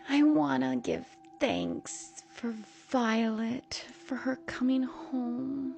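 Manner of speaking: slow, high, sugary mother voice; drawn-out grace with an eerie edge; creepy mother saying grace. A narrator's reading.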